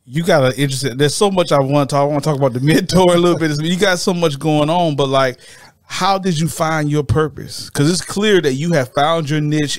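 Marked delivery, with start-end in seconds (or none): none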